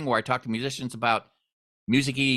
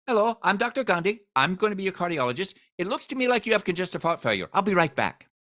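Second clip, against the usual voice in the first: imitating an Indian doctor, higher pitched; doctor from India, higher voice; Indian accent; 7 semitones higher than usual. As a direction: lightly mimic the cardiologist's Indian accent. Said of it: "he's from India".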